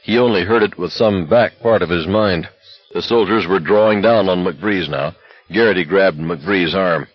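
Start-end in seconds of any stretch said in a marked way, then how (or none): none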